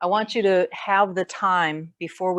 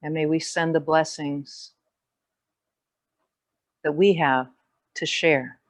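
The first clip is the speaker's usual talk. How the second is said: measured meditation voice with long pauses; guided meditation; 2 semitones lower, 4 dB louder than usual. Real, not acted. Yoga teacher leading the class into grounding and gratitude, guided meditation. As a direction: settle them into presence, phrase by phrase, unhurried.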